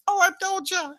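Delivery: very high, loud squeaky British falsetto. Acted. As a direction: shriek a cheery British intro in falsetto. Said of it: "everybody's speaking British accent".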